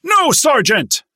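very high, very loud slow yell; yell at full voice. A narrator's reading. Recruit answering his sergeant, full-voice yell. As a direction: yell it as loud as you can. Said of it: "yelled as loud as he could muster".

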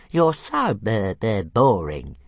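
squeaky sulky stammer; Toothless sulking. Acted. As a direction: whine the complaint. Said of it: "he said sulkily".

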